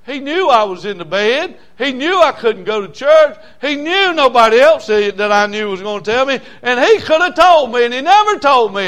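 loud high preaching wail, far above his talk; pained accusing cry. Pretend. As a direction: cry it out as Doug, wounded and betrayed. Doug accusing from the bed.

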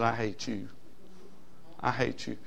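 soft, low "I hate you" recalled; quiet retold defiance. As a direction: murmur it like a sullen teen remembered. Retold teen defying his mom.

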